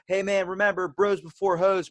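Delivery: calling out. Retold or imitated. Kid calls to brother's friend in town, calling out. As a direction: imitate a kid hollering a parting reminder.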